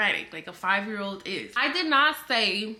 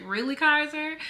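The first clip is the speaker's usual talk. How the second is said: retold incredulous question, pitched up; lightly mock a child's overreaction; amused disbelief; 6 semitones higher, 3 dB louder than usual. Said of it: "I remember laughing at the situation".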